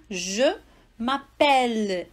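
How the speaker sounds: slow m'appelle with an open E, mapɛl. Shown as a demonstration. Teacher to student: slow French m'appelle. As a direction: draw out m'appelle to show the open E. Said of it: "mas esse aqui já é aberto".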